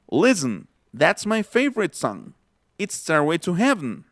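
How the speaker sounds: 'Listen' as LIS-n, 'favorite' as FAV-rit, 'Heaven' as HEV-n; natural English reductions. Shown as a demonstration. Teacher demonstrating the phrase, 'Listen', 'favorite', 'Heaven' all reduced. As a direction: swallow weak vowels in 'favorite' and 'Heaven', no t in 'Listen'. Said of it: "estoy pronunciando favorite".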